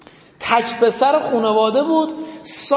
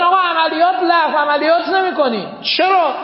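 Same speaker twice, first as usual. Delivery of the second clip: commander shouting; 7 semitones higher, 6 dB louder than usual. Retold or imitated. Commander calls off the operation.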